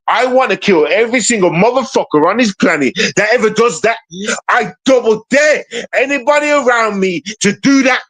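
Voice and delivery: furious, demanding to be understood; real anger. Real, not acted. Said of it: "That's why I'm getting angry".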